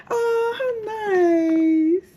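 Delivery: high, long drawn-out cooing delight; delighted squeal. Real, not acted. Traveler opening her welcome gift in delight. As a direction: draw it out slowly, high-pitched.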